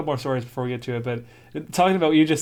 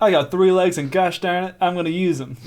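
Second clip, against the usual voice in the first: higher, louder mock resolve; mock determined; 5 semitones higher, 6 dB louder than usual. Playing the three-legged creature vowing to run, hammed-up resolve.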